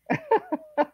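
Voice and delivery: high-pitched giggle; amused giggle. Real, not acted. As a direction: giggle in a high little burst. Laughs at a comment.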